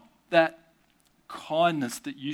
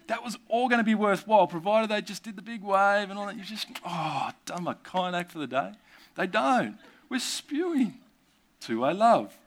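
mock indignation; 7 semitones higher than usual.

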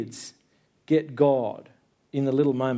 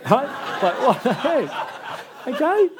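laughing disbelief; 9 semitones higher, much slower than usual. Real, not acted. Laughing at his son's retort.

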